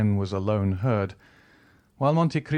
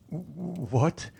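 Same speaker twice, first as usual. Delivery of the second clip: a startled, breathless gasp of a question, higher; terrified banker gasping; stammered; 6 semitones higher than usual. A narrator's reading. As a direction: catch your breath in shock. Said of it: "he stammered".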